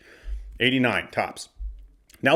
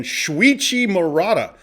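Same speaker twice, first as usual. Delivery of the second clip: slow announcer call; 6 semitones higher, 3 dB louder, slower than usual. Acted. Announcing Japanese card name.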